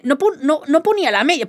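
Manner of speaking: ordinary delivery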